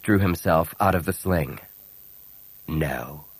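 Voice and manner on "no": low, soft, flat refusal; flat and quiet. Acted. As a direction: refuse quietly, without any inflection. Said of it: "voice was flat and quiet".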